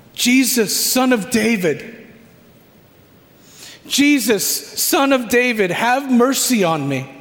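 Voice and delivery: mild acted shout, pleading to be heard; calling out. Blind man shouting to Jesus over the crowd, calling out. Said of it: "you're also shouting out that name".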